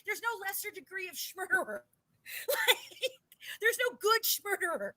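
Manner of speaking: high, laughing disbelief; incredulous laugh. Real, not acted. Host laughing to cohost at absurd murderer rebrand, high.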